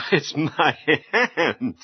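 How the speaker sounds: slow words through a chuckle; laughing. Acted. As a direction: laugh it off gently. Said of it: "You're laughing".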